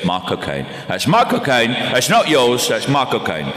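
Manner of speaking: Cockney catchphrase impression; Michael Caine. Shown as a demonstration. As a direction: punch it out in a throaty Cockney accent. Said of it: "if you wanna get into the voice".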